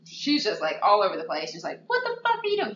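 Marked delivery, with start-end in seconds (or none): 1.9-2.8 much higher, shrill-pitched outrage; mock furious scream. Friend quoting Debbie screaming at Blue, high mock fury. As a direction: scream it at the cheating boyfriend.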